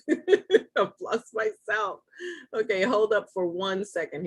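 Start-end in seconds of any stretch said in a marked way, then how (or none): 0.1-2.0 slow, higher, laughing through it. Real, not acted. Host laughing at herself after losing her Zoom window. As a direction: laugh at yourself as you repeat it.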